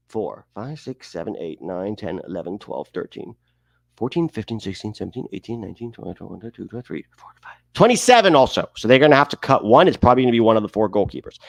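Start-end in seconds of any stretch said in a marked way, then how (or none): 0.1-7.6 soft, low running count; counting to himself. Real, not acted. Host counting names on the roster, quietly to himself. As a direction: count under your breath.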